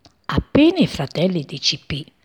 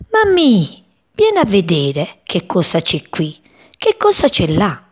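excited chick. Acted. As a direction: call out to mum like an eager chick. Little sparrow calls mother excitedly. (voce acuta ed eccitata di uccellino)

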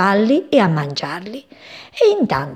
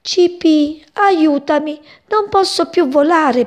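much higher and louder, pleading; tearful plea; 7 semitones higher, 4 dB louder than usual. A narrator's reading. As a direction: beg for help with a broken wing. (supplica in lacrime, acuta e forte)

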